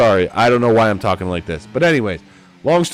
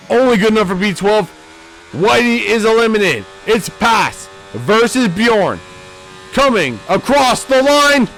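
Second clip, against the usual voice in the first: higher and louder, building to a shout; excited race call; 9 semitones higher, 4 dB louder than usual. Acted. Commentator calling the final seconds to viewers, building excitement. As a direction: ride the last-second finish hard.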